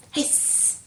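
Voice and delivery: long unvoiced snake hiss. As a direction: hiss like a sneaky snake at the door. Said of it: "just like a snake".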